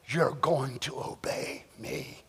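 low, slow, hushed authority; acted stern, measured undertone; quiet command. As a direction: lay down the order slowly and very softly. Deity commanding humanity, acted.

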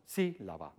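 Sílaba with SÍ stressed, the rest dropping away. Shown as a demonstration. Lecturer illustrating stressed versus unstressed syllables, SÍ-laba. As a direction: punch SÍ, let la-ba fall low and soft. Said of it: "superior que las sílabas átonas".

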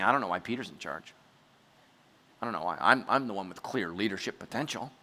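low, quiet private grumble; Judas. Acted. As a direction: grumble it low, under your breath. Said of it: "Judas is saying".

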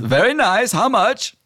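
high, fussy genie voice. Acted. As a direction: whine it like a picky genie. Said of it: "Genie says".

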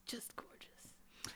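soft slow whisper; awed whisper. Acted. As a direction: sigh it out in awe. Reverent hush about [name].

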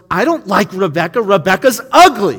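put-on a kid shouting that he doesn't like her; defensive kid. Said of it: "And then Timmy goes".

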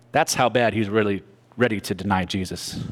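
normal talking voice

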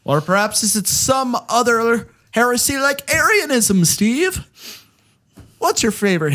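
high, lilting leprechaun voice; a poor, hammy Irish accent. Acted. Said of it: "Why are you talking like a leprechaun?".

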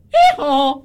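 high, loud laughing hee-haw; donkey bray. Pretend. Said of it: "there's a donkey".

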